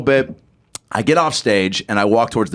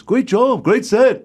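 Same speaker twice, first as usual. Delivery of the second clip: high-pitched praise from a star; high impersonated congratulations; 10 semitones higher, much slower than usual. Retold or imitated. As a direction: hand out a compliment in a high put-on voice. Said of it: "he goes".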